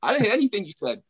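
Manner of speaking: high-pitched, incredulous reaction; hammed-up confused protest, pitched high; mock bafflement. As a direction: act totally lost at what was said. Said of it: "You're like".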